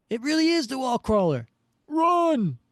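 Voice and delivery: high acted cry of alarm; panicked alarm. Thug warns his pals about the hero, panicked. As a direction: build to a high, loud yell. Thugs recognise Spider-Man and flee.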